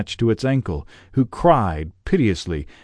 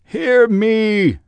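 loud, much higher ghostly command; cried out; 12 semitones higher, 5 dB louder than usual. A narrator's reading. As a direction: demand to be heard. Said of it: "cried the ghost".

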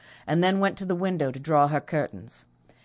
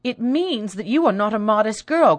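louder, higher accusing cry; jealous sister accusing, higher and louder; angry cry; 6 semitones higher, 7 dB louder than usual. Acted. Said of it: "cried Rosalind".

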